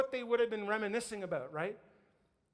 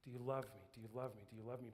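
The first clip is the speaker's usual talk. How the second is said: very low, hushed repetition; soft, deep and solemn; tender questioning; 9 semitones lower, 13 dB quieter, much faster than usual. Acted. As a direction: murmur it gently, each time softer. Repeats Jesus's question to Peter.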